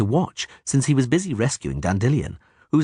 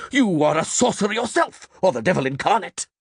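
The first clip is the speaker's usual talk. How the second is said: high, strained accusing cry; priest's bellow; 9 semitones higher than usual. A narrator's reading. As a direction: denounce him at the top of your pitch. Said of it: "the priest bellowed".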